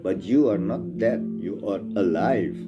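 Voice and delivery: ordinary delivery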